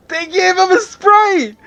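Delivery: high, slow delighted squeal; excited squeal. Real, not acted. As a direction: squeal with joy. Excited reaction to a Sprite.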